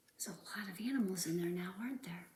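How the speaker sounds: quick hushed whisper; fast whisper. Acted. Reader whispering to the kids, fast. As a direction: rush it under your breath.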